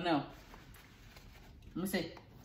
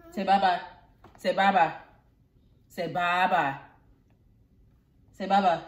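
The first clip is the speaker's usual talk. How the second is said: loud coaxing; 8 dB louder than usual. Real, not acted. Coaxing kids to wave goodbye.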